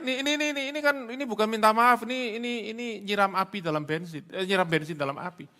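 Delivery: high, loud, stammering repetition; agitated exclamation. Shown as a demonstration. Preacher objecting to an apology that fuels fire, agitated. Mock alarm at a bad apology.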